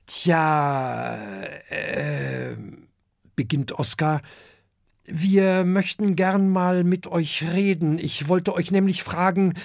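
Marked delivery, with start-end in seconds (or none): 0.1-2.6 drawn-out, uncertain beginning; hesitant young dragon. Acted. Oskar nervously starts his request. 5.0-9.5 put-on high child-dragon voice; young dragon Oskar.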